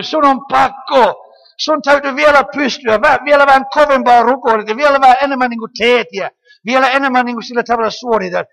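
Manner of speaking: loud, driving preacher voice pressing demands; preaching at full force. Acted. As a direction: hammer each demand home from the pulpit. Preacher voices the spirit of slavery. (kovaääninen, painokas saarnaääni)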